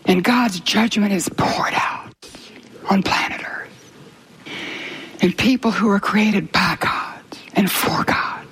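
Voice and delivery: slow, breathy sermon whisper; hushed whisper. Real, not acted. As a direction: whisper it like a grave warning. Solemn sermon on God's judgment.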